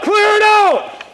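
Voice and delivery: high, slow, drawn-out call; slow command shout. Real, not acted. Repeated in-game command.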